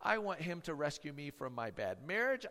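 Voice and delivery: usual speaking voice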